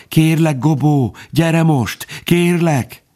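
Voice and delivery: high-pitched, barely able to speak; desperate pleading. A narrator's reading. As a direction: beg in a high, frightened voice. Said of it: "aki már alig tudott szólni, suttogta". (kétségbeesetten könyörögve, magas hangon)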